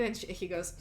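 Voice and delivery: usual speaking voice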